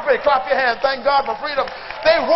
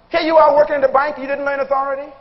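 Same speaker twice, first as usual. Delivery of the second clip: ordinary delivery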